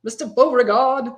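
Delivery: mock old-time Southern gentleman's accent. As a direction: hail a gentleman in a put-on Southern drawl. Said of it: "put a Southern accent on that".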